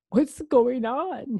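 high, drawn-out worried question; nervous. A narrator's reading. Vampire in the dentist's chair, high and nervous. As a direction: ask it loud, slow and uneasy.

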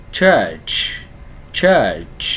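"church" with a long falling vowel and a released ch; ơ dài. Shown as a demonstration. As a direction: stretch the middle of "church", then puff the final ch. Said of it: "Âm ơ dài nè". ("church" kéo dài âm ơ, bật hơi ch cuối)

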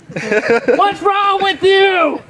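hammed-up disbelief at her; mock exasperation. Acts deaf to audience member.

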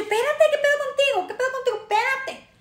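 high, repeated push-back; acted exasperated protest. Woman fending off man in club, high mock exasperation.

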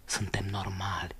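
breathy whispered question; hushed whisper, confiding. Acted. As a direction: drop to a whisper on suntem normali. Confiding reassurance.

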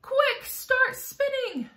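high, drawn-out urgent command; cried out. A narrator's reading. James urges the silkworm to spin, crying out. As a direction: order it urgently, stretching the words high. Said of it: "cried James".

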